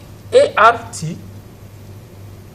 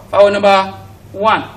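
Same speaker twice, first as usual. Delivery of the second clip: normal talking voice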